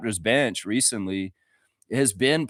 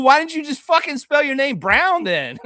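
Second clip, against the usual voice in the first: ordinary delivery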